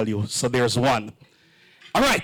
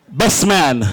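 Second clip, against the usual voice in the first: normal talking voice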